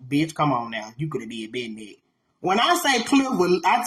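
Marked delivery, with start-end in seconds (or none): 0.1-1.9 low, soft mock disapproval; judging undertone. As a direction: imitate onlookers judging under their breath. Voices onlookers' judging looks.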